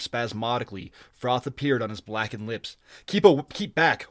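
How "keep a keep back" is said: raised, slow warning; urgent order. A narrator's reading. Lord ordering the stranger away, urgent command. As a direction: order him off, higher and louder. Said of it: "ordered Shah Amrath".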